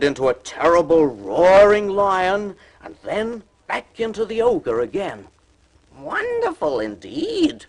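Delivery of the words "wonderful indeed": very high, slow flattering cry. A narrator's reading. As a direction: gush with sly admiration. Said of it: "cried Puss".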